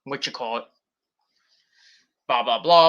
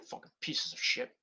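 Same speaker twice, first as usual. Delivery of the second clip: muttered curse; 13 dB quieter than usual. Real, not acted. Cursing trolls under breath.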